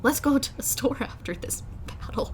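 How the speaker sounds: hushed, whispered deadpan aside; sarcastic hero impression. Mimicking a bored hero mid-battle, sarcastic whisper. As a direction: whisper it to yourself, offhand. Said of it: "he's sarcastic and he's just like".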